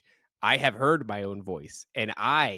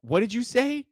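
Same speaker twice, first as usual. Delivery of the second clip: very high, rising question; high mock-clueless voice; imitated wife; 12 semitones higher than usual. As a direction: mimic a puzzled wife asking back. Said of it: "then she'll go".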